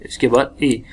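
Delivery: usual speaking voice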